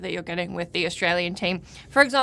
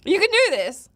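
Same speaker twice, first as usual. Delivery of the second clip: excited cheering; 10 semitones higher, 5 dB louder than usual. Real, not acted. Cheering on the eater.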